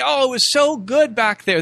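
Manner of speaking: put-on nostalgic complaint; mock complaining. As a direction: imitate grumblers pining for the past. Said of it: "they're complaining over it and say".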